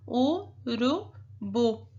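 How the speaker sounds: "Urubu" stretched slowly, syllables apart. Shown as a demonstration. Teacher putting the syllables back together. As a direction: draw out "Urubu" evenly. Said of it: "pintar somente as sílabas".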